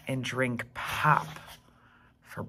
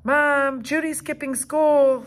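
higher, louder tattling yell; yelling tattletale; 11 semitones higher, 7 dB louder than usual. Acted. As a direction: yell to Mom to tell on her. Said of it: "Stink yelled".

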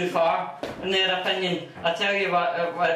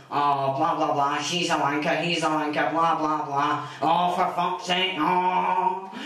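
mocking insulter; 3 semitones lower than usual. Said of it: "you hear people going".

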